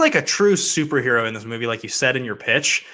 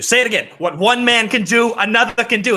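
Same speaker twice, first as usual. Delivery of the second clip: rising intensity; 8 semitones higher than usual. Retold or imitated. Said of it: "And Baldwin goes".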